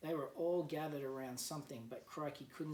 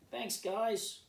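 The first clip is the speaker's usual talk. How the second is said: louder, higher call of thanks; 7 semitones higher, 5 dB louder than usual. A narrator's reading. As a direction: shout thanks across to them. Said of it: "called Crikey and Sheila".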